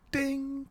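high sung ding like a piano; piano chime imitation. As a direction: chime it like a little piano note. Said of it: "the wee chime piano".

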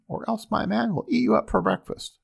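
high, loud suspicious woman; suspicious giantess. Acted. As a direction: challenge him, half suspecting.

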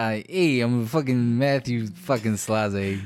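put-on slow, loud Brooklyn accent; tough-guy Brooklyn accent, drawn out. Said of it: "It was the Brooklyn accent".

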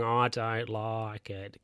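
Ron's catchphrase. Retold or imitated. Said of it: "Ron's going".

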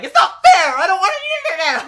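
high, very loud tantrum yell; shouting. Retold or imitated. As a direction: imitate a man losing his cool, shouting. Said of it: "audio logs where he's shouting".

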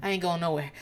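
calm, low and soft; Karen. Retold or imitated. Recapper quoting woman refusing to leave, calm as can be. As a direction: imitate someone utterly unbothered, low-key. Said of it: "calm as fuck".

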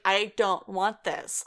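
"I don't want this" slowed right down; "I don't want this", slow and emphatic; firm refusal. Retold or imitated. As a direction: space out every word of "I don't want this". Retold refusal, repeated.

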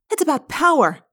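high, emphatic charge; accusing agreement. A narrator's reading. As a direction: hit "power" hard. Rachel joins Marco's accusation.

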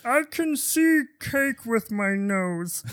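goofy inbred prince. Acted. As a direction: drag it out like a dim-witted royal. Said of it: "That voice".

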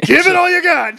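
high-pitched, hammed-up yell; movie-quote shout. Acting out a Pacino and De Niro face-off, hammed-up shout. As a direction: belt it out like an over-the-top movie line. Repeats the acted movie line.

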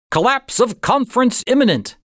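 high, punchy headline read; headline announcer. A narrator's reading. Narrator reading the newspaper headline as an announcer. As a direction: announce it like a newsreel.

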